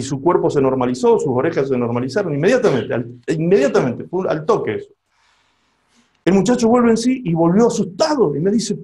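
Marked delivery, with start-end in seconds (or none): none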